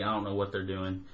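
ordinary talk

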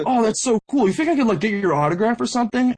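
high, excited fan imitation; eager fan. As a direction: act out asking a hero for an autograph. Retold fan asks for autograph.

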